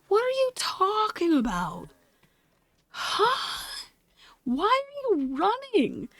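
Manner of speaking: high, bewildered questions; confused. Retold or imitated. Skater questioning the chasing stranger, high and bewildered. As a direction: sound lost and weirded out.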